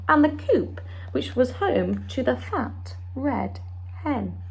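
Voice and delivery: lively storybook read-aloud with character voices; story time voice for a children's picture book. Acted. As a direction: read it to a class of little ones, bright and expressive.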